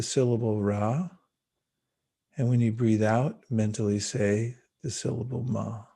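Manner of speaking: calm spoken meditation with long pauses; guided meditation. Acted. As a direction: ease them gently into the breath.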